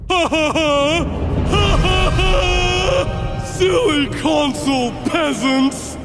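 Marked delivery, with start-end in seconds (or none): 0.1-3.4 loud, high "ha ha ha"; big stagey cackle, held long; villainous gloating laugh. Acted. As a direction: laugh down at your inferiors. Acted gloating gamer villain.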